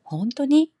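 high, loud surprised 本当に?; surprised question. Shown as a demonstration. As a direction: gasp out 本当に? in disbelief. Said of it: "이게 표준어라면".

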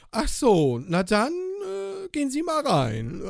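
put-on high, drawn-out old lady voice, slow; mock old lady, slow and high; grumpy old woman. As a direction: mimic the old woman giving in. Old woman voiced in retold story.

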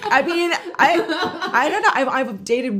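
laughing through the words; high, giggly laughing answer. Real, not acted. Laughing after friend's joke.

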